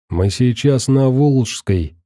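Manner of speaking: plain reading voice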